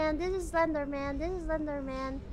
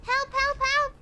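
calling for help; 10 semitones higher, 5 dB louder, slower than usual. Real, not acted. Chased in horror game.

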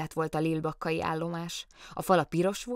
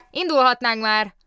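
louder, impatient call from the engine; 7 semitones higher, 9 dB louder than usual. Acted. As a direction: hurry them along with a shout. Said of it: "kiáltott oda".

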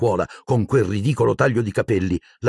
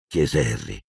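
ordinary delivery, nothing marked

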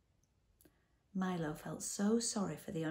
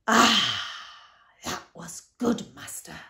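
big, loud roar of relief, then easing off; bellowing; 9 dB louder, slower than usual. A narrator's reading. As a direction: bellow it as a huge monster let loose. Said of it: "he bellowed".

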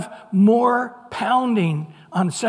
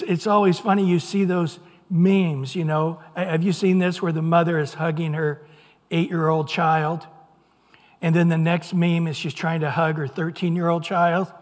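ordinary talk, nothing marked

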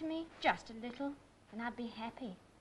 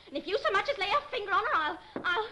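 angry threat; 6 semitones higher, 4 dB louder, faster than usual. Acted. Threatens him over her sister.

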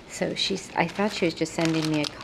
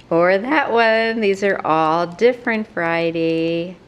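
giddy emphasis; 7 dB louder than usual. Real, not acted. Amazed at yet another new paper, gleeful. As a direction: pile on the excitement. Laughing after listing more papers.

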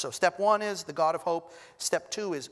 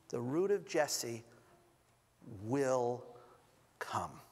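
hushed promise; 5 semitones lower, 5 dB quieter, slower than usual. Acted. Prophetic promise, slowed and hushed.

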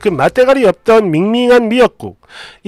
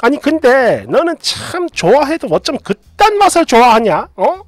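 higher, scornful mother-in-law voice; disgusted mother-in-law; 5 semitones higher than usual. Acted. Mother-in-law scorns daughter-in-law's favourite flavour, raised disgusted voice. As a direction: heap scorn on her taste.

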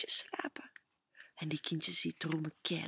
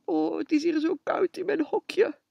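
higher, louder plaintive dog voice; shivering dog; 10 semitones higher, 7 dB louder than usual. Acted. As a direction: whine like a cold little dog. Dog voiced in bedtime story. (klagerig hondje, hoger en luider)

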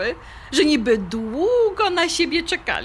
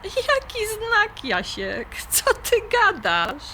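incredulous; 8 semitones higher, slower than usual. Acted. Incredulous at his sign talk.

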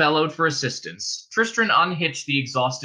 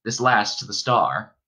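plain reading voice

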